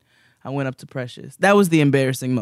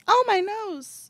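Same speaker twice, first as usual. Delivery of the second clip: mock lament; slower than usual. Real, not acted. Mock lament over nose.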